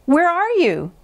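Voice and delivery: high, worried question; high-pitched searching cry; Toad calling out. A narrator's reading. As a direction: call into the dark for a lost friend. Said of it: "said Toad".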